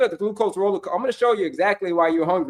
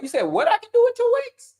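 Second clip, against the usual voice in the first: pitched way up, incredulous echo; mock disbelief. Coach echoing a viewer's doubt, mock shocked. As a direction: play the stunned viewer repeating the claim.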